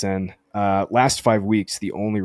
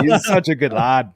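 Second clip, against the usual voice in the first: put-on quick jokey Irish accent, louder and higher; 5 semitones higher, 6 dB louder than usual. Host praising his favourite player in a mock Irish accent. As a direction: toss off a hammy Irish lilt. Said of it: "My, my Irish accent".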